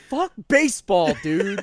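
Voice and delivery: high, loud meltdown yell; frantic yell. Retold or imitated. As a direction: yell it like he's losing his mind. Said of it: "a man having a mental breakdown".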